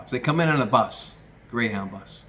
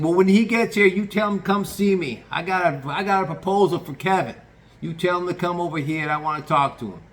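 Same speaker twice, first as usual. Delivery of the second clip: loud, much higher boss giving orders; bossy loud; 7 semitones higher, 5 dB louder than usual. Retold or imitated. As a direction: bark orders like the kitchen boss.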